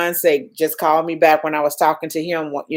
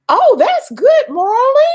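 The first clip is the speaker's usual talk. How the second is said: high squeal of joy, near tears; thrilled, voice cracking with emotion; excited delight; 6 dB louder than usual. Real, not acted. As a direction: burst into overjoyed praise. Reading good news in comments.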